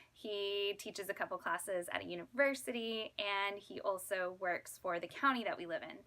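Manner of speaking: ordinary talk, nothing marked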